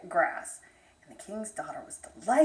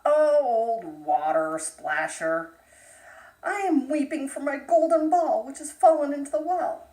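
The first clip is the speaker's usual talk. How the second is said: louder, tearful princess calling out; upset princess; 4 semitones higher, 8 dB louder than usual. Acted. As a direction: lament to the frog, voice raised. Princess weeping over her ball.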